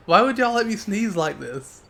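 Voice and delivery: mock complaint. Real, not acted. Complaining after sneezes.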